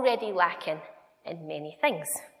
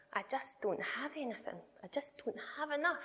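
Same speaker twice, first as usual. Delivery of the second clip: put-on quoted sad child; 4 semitones higher, 13 dB quieter than usual. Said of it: "And he said".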